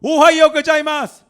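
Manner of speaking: very loud, high-pitched mock Japanese greetings; high-energy greeting. Japanese believers greeting everyone everywhere, exuberant high shout. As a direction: blast each greeting across the room. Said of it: "텐션이 그냥 딱 보자마자".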